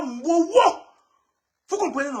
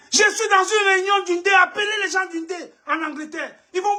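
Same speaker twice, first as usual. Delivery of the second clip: tearful shout; 6 semitones higher than usual. Real, not acted. Outraged protest mid rant.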